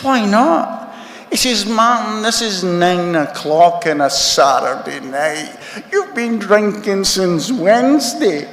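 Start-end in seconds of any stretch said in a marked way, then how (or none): 0.0-0.9 high-pitched mock protest; indignant retort. Retelling his retort to cousin. 1.6-8.5 high-pitched mock reproach; incredulous scolding. Cousin scolds him in retold exchange.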